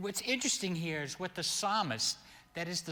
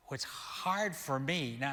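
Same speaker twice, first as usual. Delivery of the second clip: normal talking voice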